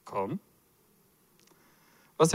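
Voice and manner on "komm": low, soft, laid-back "Komm"; a lightly voiced cool Jesus. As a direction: toss the word off, totally unbothered. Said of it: "so cool wie er ist".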